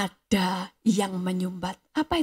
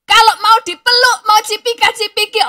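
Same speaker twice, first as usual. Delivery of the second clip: exclaimed mockery; 10 semitones higher, 8 dB louder than usual. Mocking pushy hugging greeters.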